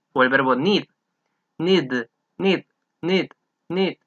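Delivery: "need" with a clear voiced D at the end; final d sound. Shown as a demonstration. Teacher showing students the final D of need. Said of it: "Es una D".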